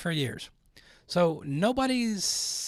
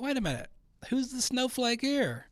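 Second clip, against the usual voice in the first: mock disbelief; 8 semitones higher than usual. Reacting to the crying rocker in mock disbelief. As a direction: ask it like you can't believe it. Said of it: "I'm like".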